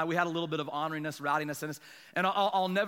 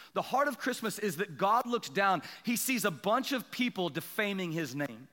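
normal talking voice

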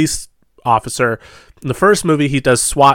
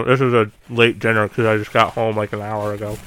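mouth full; 3 semitones lower, 5 dB quieter than usual. Real, not acted. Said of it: "not talk with my mouth full".

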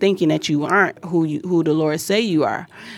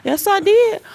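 much higher, squeaky put-on yeah-no-yeah; mock squeaky protest; slower than usual. Teasing her co-host, she squeaks a defence in a mock high voice. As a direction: squeal it like a cartoon defending herself.

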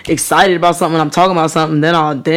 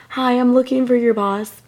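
high, softer walk-in customer voice; pretend customer; 5 semitones higher, 5 dB quieter than usual. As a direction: play a polite visitor asking at the desk. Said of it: "if I walked in and was like".

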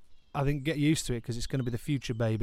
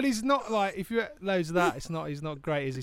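normal talking voice

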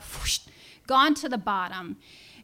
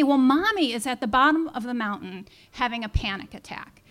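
usual speaking voice

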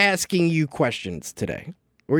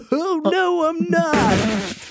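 high mocking Denny voice, cheerfully backing out; a higher, carefree brush-off in a mock voice; Denny impression; 12 semitones higher, much slower than usual. Host mocks the no-show DJ backing out, high and mocking. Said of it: "That was a pretty good Denny, too".

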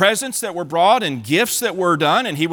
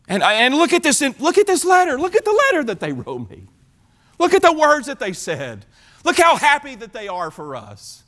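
higher, eager look-at-this boasting; proud excited king; 8 semitones higher than usual. Acted. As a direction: brag like a delighted host. Hezekiah shows the letter.

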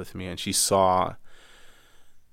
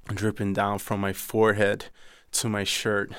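ordinary delivery

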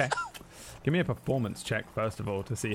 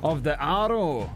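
dwarf voice a touch higher, Scots lilt, spinning a tall tale; boastful dwarf storyteller; 3 semitones higher than usual. Acted. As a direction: lean in and savour every boast.

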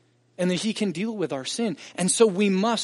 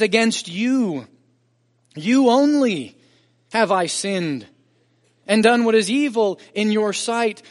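fervent scripture reading; 5 semitones higher, 6 dB louder than usual. Acted. Pastor preaching David's confession to the congregation, impassioned. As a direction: proclaim it with full force.

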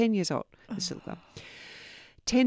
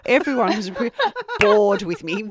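laughing; 3 semitones higher, 8 dB louder than usual. Real, not acted. Telling co-host about her own long labour, laughing. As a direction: crack up mid-sentence.

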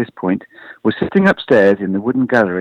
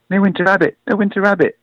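high-pitched, mildly projected excited cry; little one's excited shout; 11 semitones higher than usual. A narrator's reading. Baby rabbit Pook shouting for the Midwinter Rabbit, excited. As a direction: call it out high, like an eager little child. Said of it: "Pook shouted".

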